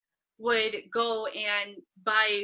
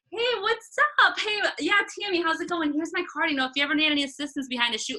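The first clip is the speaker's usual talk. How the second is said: much higher, bright and bouncy hello; breezy networking pitch, much higher and fast at the end; confident greeting; 7 semitones higher, faster than usual. Retold or imitated. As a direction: sell yourself like an old friend. Confident networker after growing comfortable.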